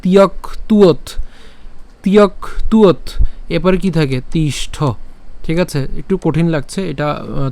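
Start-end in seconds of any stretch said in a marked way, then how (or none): 0.0-3.0 higher, তিয়ক তুয়ত in two parts ending on t; added final t. Shown as a demonstration. Teacher adding a letter, তিয়ক, pause, তুয়ত. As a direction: close তুয়ত firmly with a t. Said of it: "এখানে একটা ত নিয়ে আসেন".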